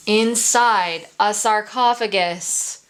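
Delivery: ordinary delivery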